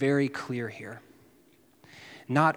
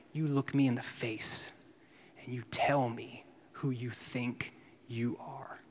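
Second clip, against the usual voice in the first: a hushed, menacing dare; hushed challenge; 2 semitones lower, 9 dB quieter than usual. Retold or imitated. High priest Caiaphas challenging Jesus.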